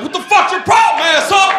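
furious high, loud yell; road-rage bellow; angry driver. Acted. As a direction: shout like a driver being honked at. Acts angry driver in front.

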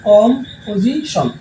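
Composition slowly in separate chunks; split into syllables. Shown as a demonstration. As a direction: pause inside composition between its parts. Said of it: "তিনবার ভেঙে ভেঙে যে উচ্চারণ".

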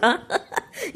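a soft rising giggle; light laugh. Real, not acted. Telling viewers the daughter didn't like the ride, soft rising giggle.

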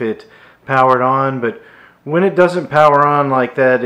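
energetic vlog sign-off narration; presenter voice-over. Acted. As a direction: deliver a punchy, emphatic video outro. Recorded wrap-up voice-over to viewers.

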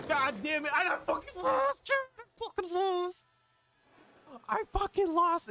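high-pitched outraged whine, repeated; frustrated wail. Real, not acted. Streamer to chat after losing the trick shot, a high frustrated wail. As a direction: wail it up high, disbelieving the loss. Loses the final kill.

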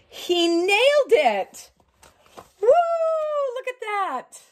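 cheering as Daddy finishes the hair; excited cheer. Real, not acted. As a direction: celebrate it out loud.

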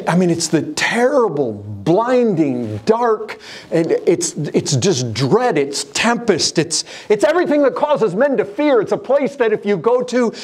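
full-force pulpit preaching; fiery preaching. Acted. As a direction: preach it high and hard to the congregation.

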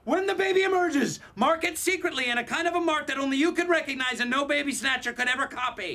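high, strained instructions; urgent mock labour. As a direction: give orders between pretend contractions. Instructions during pretend labour.

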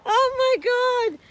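usual speaking voice

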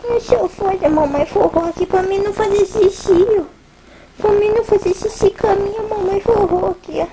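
playful pretend baby voice; baby's voice. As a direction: speak for the baby in playful baby talk. Mother voices her baby.

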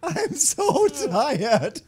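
high, slow, loud mock whine with a laugh; imitating a tired angler. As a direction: stretch each word out, laughing. Mocks the angler in the clip.

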